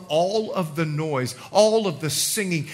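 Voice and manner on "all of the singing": ordinary delivery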